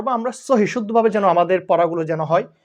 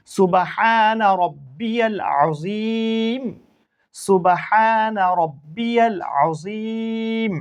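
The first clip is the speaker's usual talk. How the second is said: slow, drawn-out Arabic recitation; prayer recitation; 3 semitones higher, much slower than usual. Shown as a demonstration. Teacher recites the prayer for students, slow and drawn out. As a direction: chant each word slowly and clearly for learners. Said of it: "রুকুর তাসবিহ".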